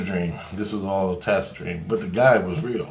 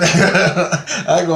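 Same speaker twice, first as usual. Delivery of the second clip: usual speaking voice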